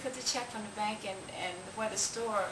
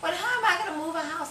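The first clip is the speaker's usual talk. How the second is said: louder and higher, rushing in dismay; upset outburst; 8 semitones higher, 9 dB louder, faster than usual. Retold or imitated. Mary reacting to being told to move the house, a fast upset outburst. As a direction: blurt it out fast in distressed disbelief.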